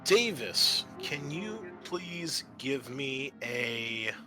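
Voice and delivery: ordinary talk, nothing marked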